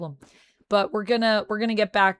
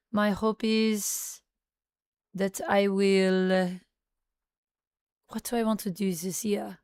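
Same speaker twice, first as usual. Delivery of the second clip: put-on French accent. Streamer granting a viewer's request, in a French accent. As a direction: speak English like a French speaker. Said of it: "I can do a French accent".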